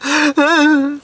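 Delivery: high, strained whimper of a laugh; squealing emotional laugh. Retold or imitated. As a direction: squeak out a half-sob laugh. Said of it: "I was getting all emotional".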